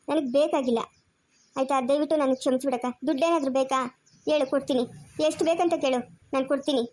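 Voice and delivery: ordinary talk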